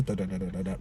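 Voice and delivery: low, soft da da da of a rattling car; imitated bumpy road. Shown as a demonstration. Showing how the car shakes on a bad road, soft low rattle. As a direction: mimic tires over rough road. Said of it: "it makes the car go like this".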